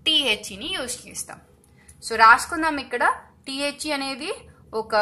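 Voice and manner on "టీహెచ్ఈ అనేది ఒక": normal talking voice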